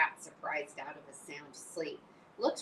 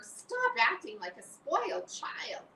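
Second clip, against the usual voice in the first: scolding; 10 semitones higher, 5 dB louder, slower than usual. Acted. Wilbur scolding after scream.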